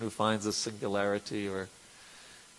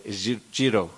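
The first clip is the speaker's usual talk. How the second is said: put-on Bengali accent; 3 dB louder than usual. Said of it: "is Bengali accent".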